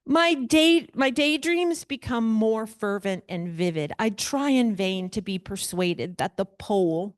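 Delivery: reading the letter aloud with a grand lilt; dramatic reading. Acted. Teacher reading the Frankenstein letter to the class, dramatic. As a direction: lean into the ornate prose.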